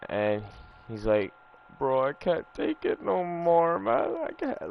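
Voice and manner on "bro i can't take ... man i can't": high, wavering mock cry that breaks upward; imitating bawling friend. Retelling his friend's breakup call, mock crying. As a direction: play the heartbroken buddy, half-hearted sobbing. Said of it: "he start bawling, crying".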